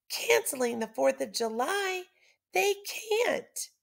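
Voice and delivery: outraged can't-believe-it; shocked disbelief. Acted. Liberty hearing the holiday is cancelled, shocked. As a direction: voice jumps up in disbelief.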